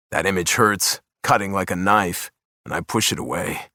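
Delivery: ordinary delivery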